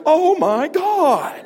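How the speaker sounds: slow, high, loud panicked wail. Retold or imitated. Southern driver swarmed by Brooklyn traffic, panicked wail. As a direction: stretch it out in mock terror. Cars coming at him.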